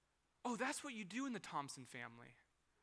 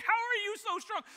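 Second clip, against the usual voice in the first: high, loud exasperated shout; 14 semitones higher, 9 dB louder than usual. Retold or imitated. Dad fighting toddler into seat, high strained shout.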